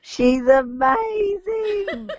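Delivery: drawn-out gushing cry of delight; excited. Real, not acted. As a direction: stretch it out, thrilled and loud. Raving about Celine's video.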